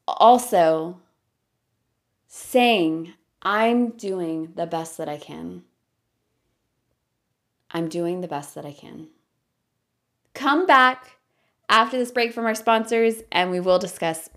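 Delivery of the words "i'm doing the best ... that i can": loving affirmative tone. Shown as a demonstration. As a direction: ease into it, kind and unhurried. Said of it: "you say it in the most loving, affirmative, friendly tone".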